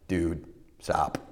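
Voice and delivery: low, soft, casual nudge; understated, offhand inner warning; own inner voice. Retold or imitated. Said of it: "It's my voice going".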